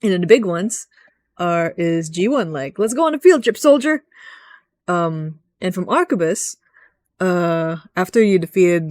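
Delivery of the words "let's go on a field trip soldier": higher, louder mock drill-sergeant call; mock officer rallying a soldier; gung-ho commander. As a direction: bark it like a cheery commander sending troops out. Quoting a game character's briefing.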